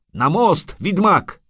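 high, pressing call; urgent shouted order. Acted. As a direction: call out to hurry someone along. Urgent order amid falling rocks.

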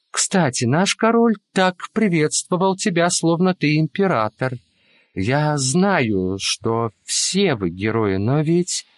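ordinary delivery, nothing marked

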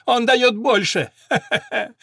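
high, slow, words through laughter; mock him while laughing. A narrator's reading. Owner ridiculing the Englishman, laughing through words. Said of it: "покатывался Васильковский".

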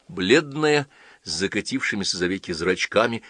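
ordinary delivery, nothing marked

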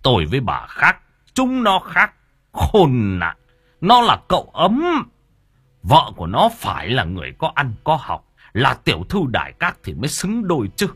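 angry tirade surging into high shouts; relentless scolding. A narrator's reading. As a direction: rail at his wife, spitting out the insults. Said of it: "quay sang mà chửi vợ xa xả". (chửi xa xả)

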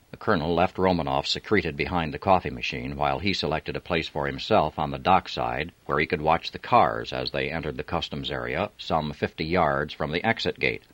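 usual speaking voice

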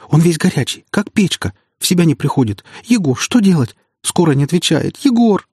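high, panicked, tearful pleading; sobbing, near wailing. A narrator's reading. Woman begging friend to help sick man, sobbing. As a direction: plead desperately, climbing towards a wail. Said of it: "слышался уже натуральный вой".

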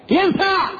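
ordinary talk

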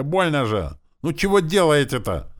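high, loud pained protest; indignant cry. A narrator's reading. Boy to whoever twists his ear, indignant cry. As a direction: protest loudly as if hurt. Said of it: "возмущённо вскричал я".

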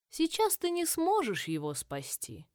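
put-on slow, grave old dryad; lightly voiced tree crone; ancient dryad. Dryad voice, held.

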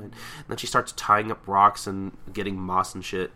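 ordinary talk

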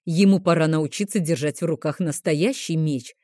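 ordinary delivery, nothing marked

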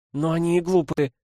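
annoyed retort; irritated. A narrator's reading. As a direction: toss it off with annoyance. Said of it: "ответила Цзиньвэй раздражённо".

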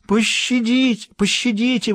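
high, slow howling plea. A narrator's reading. Governor howling for mercy, high and slow. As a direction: howl for mercy. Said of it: "выл губернатор".

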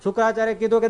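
ordinary talk, nothing marked

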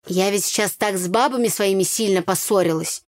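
ordinary delivery

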